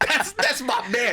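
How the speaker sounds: high, loud, slow mock outrage; mom screaming. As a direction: imitate mom fiercely defending her husband. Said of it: "she starts screaming".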